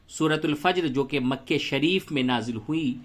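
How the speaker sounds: ordinary delivery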